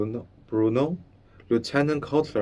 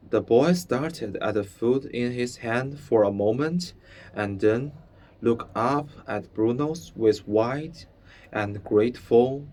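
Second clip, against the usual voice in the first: plain reading voice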